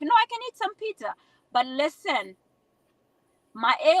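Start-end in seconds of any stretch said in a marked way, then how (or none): 0.0-1.1 mock protest. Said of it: "I'm like".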